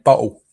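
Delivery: "bottle" with a glottal stop for the tt; dropped t. Shown as a demonstration. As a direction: punch out "bottle" with the t's swallowed. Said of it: "Did it again".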